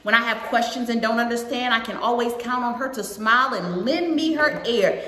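put-on lilting read-aloud to a young audience; storybook narration with singsong rhythm; picture book read-aloud. A narrator's reading. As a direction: read it out brightly, line by line.

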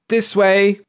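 high, loud call to follow; rallying cry. A narrator's reading. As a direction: cry out to lead the charge. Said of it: "cried Missus Bellows".

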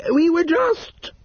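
very high, slow flustered spinsters; caught out. A narrator's reading. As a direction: stall guiltily. Spinsters caught, stammer together.

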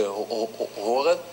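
repeated stuttered first syllable; real stutter. Real, not acted. Guest on radio explains stuttering, stuttering on the word. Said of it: "ik stotter nog steeds".

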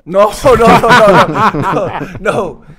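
laughing no no no. Real, not acted. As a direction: protest while cracking up. Laughing denial.

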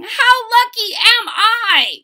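big, high, loud joyful shout, drawn out. Acted. Storyteller calling out to the child, a joyful shout. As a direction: shout it out with delight. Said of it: "you ought to be shouting".

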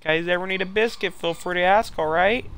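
high, polite put-on voice; eager-to-please neighbour. Neighbour offering the guys a biscuit, high polite voice.

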